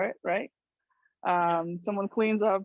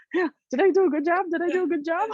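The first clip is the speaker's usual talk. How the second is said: high, fast, eager to please; eager dog; 10 semitones higher, 4 dB louder, much faster than usual. Retold or imitated. The dog bringing back the stick, high and eager. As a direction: beg for praise like a panting dog. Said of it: "and like".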